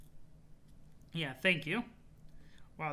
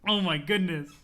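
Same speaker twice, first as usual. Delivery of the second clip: laughing; 3 semitones higher, 6 dB louder than usual. Real, not acted. Streamer thanking listeners for liking the voice, laughing. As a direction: say it while cracking up. Cracking up at her own voice.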